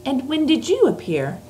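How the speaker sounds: put-on a light, lifted girl's voice; little girl voice. Reads Sophie's lines.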